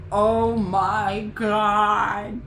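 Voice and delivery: loud, drawn-out, choked-up cry; overwhelmed. Retold or imitated. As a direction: stretch it out, overcome by the book. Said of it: "I was like".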